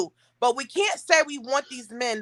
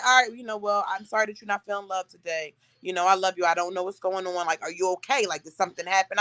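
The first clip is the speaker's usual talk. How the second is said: low, softer, apologetic imitation; patient partner voice, lowered and quieter; soothing boyfriend; 7 semitones lower, 5 dB quieter than usual. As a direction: drop down low and gently check on her. Retold boyfriend apologising, soothing, checking.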